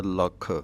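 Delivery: ਲੱਖ with a held, doubled kh; short vowel then a firm kkh in ਲੱਖ; doubled consonant. Shown as a demonstration. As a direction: stress the adhak in ਲੱਖ. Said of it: "ਅੱਧਕ ਲਗਾ ਕੇ ਬੋਲਣਾ ਹੈ".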